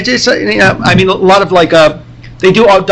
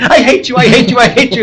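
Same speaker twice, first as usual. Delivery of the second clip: high, sing-song kid mockery of a soap line; mocking soap opera; 7 semitones higher than usual. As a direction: tease it like kids aping a melodrama. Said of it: "run around the backyard going".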